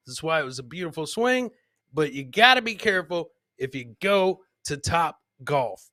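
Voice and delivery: ordinary talk, nothing marked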